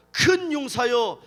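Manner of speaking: loud, slow angelic proclamation; 외치는 천사. Acted. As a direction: call out solemnly, drawing each phrase long. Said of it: "소리를 지르면". (크고 느리게 선포하는 천사 목소리)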